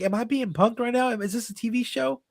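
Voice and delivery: imitated freak-out in a high voice; high, disbelieving mock excitement. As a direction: play a flustered fan, pitched high. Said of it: "she's, like, freaking out".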